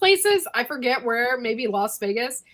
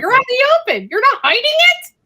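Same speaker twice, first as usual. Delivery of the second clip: high, loud incredulous cry; telling friends about Salem, loud and high in disbelief; shocked disbelief; 10 dB louder, faster than usual. Retold or imitated. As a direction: exclaim it like you can't believe it. Shocked at open smoking.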